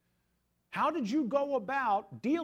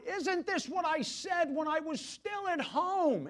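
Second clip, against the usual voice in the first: Jonah's complaint; 8 semitones higher, 3 dB louder, slower than usual. Retold or imitated. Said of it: "he prayed to the Lord".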